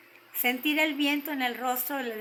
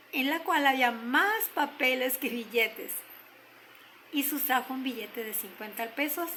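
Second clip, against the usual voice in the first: plain reading voice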